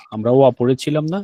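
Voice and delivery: ordinary talk, nothing marked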